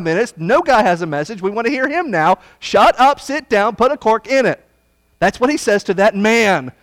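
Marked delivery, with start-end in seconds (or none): 2.6-4.7 "Shut up, sit down" shouted higher and louder; emphatic command. Acted. Preacher ordering the prophet off the stage, loud emphatic. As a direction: hammer each command home, loud. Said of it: "why I'm emphasizing it that way".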